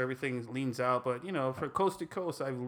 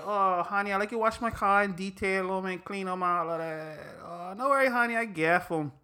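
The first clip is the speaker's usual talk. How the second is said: mock wife; 4 semitones higher than usual. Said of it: "your wife tell you".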